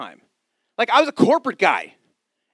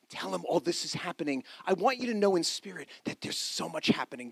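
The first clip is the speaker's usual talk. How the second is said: much softer, hushed encouragement; soft pastoral voice; 7 dB quieter than usual. Acted. Pastor reassuring the congregation in waiting times, hushed and soft. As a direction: lean in and comfort the weary listener.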